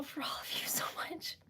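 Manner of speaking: tender hushed thanks; whispered gratitude. Real, not acted.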